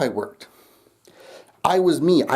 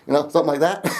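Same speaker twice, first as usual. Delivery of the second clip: ordinary talk, nothing marked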